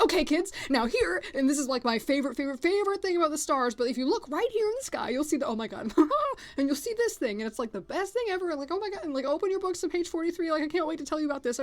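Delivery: a teacher bursting with excitement at her favorite topic; teacher rattling off star facts to her class, overexcited; excitable teacher. Retold or imitated. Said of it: "she does get really, really excitable".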